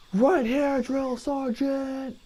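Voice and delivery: high, drawn-out recruit's call; recruit answering. Retold or imitated. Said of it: "I go".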